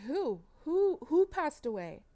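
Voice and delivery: high, loud frantic questions; a mother panicking, shouting the question out; panicked cry. Retold or imitated. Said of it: "Jasmine's freaking out".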